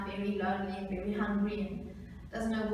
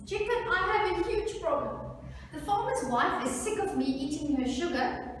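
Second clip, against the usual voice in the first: higher-pitched worried mouse pleading; 7 semitones higher than usual. Acted. Mouse tells chicken of a huge problem, worried and high.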